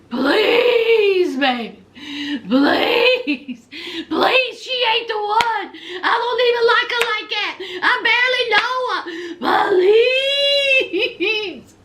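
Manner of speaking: high, wailing mock plea. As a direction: beg like an R&B crooner. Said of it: "begging like [name]".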